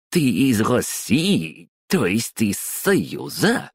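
put-on high, slow caricature Odessa accent; одесский акцент. A narrator's reading. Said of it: "С карикатурным, почти анекдотическим одесским акцентом". (карикатурный одесский акцент)

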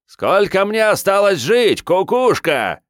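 very high, slow mocking creature voice; creepy high creature voice. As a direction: taunt slowly in a high freakish voice. Creature voice mocks hero. (высокий голос уродца)

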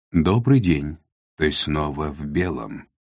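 usual speaking voice

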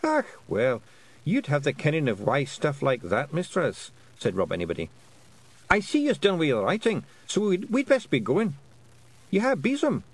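high, lilting Scots wee-man voice; a tiny Scots chief, earnestly explaining; Feegle voice. Acted. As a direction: Rob Anybody explaining to Tiffany, high Scots voice. Feegles talk to Tiffany.

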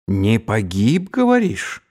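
put-on drunk khan sneering back at Sabudai's words; mocking echo. Batu mockingly repeats Sabudai.

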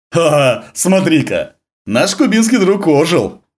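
high, loud sneering laugh; mocking jeer, slow and gloating; mustache twirling villain. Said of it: "ехидно засмеялся морской пехотинец".

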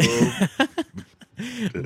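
a sudden high laugh at the joke; high burst of laughter. Real, not acted. A host laughing at his friend's joke, high and bright.

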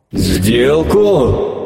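hissed creature question; hissing monster. Acted. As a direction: hiss it like a wary sea monster. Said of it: "прошипел голос".

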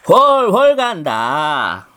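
put-on very old man. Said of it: "또 큰 소리가 들렸어요".

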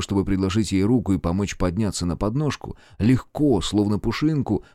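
usual speaking voice

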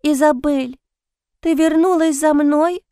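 put-on small child's whisper. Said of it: "прошептал".

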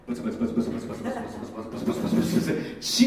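fast unvoiced mock mutter, laughing; mock mumbled prayer. Pastor mocking rote prayer to the congregation, fast whispered mumble. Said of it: "違うから".

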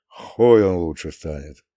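low, falling, resigned line; hopeless sigh. A narrator's reading. Grandpa giving up on the canned food, hopeless sigh. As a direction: let it sink like a hopeless sigh. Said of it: "Издав этот полный безнадёги вздох".

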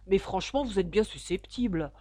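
ordinary delivery

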